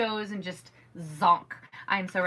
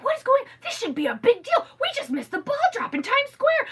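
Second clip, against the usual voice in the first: much higher, indignant retold rant; upset outburst; 14 semitones higher, 4 dB louder than usual. Mom retelling missing the countdown, in a high indignant outburst. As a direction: rant it like the biggest injustice, pitch shooting up. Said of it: "Okay, let me calm down".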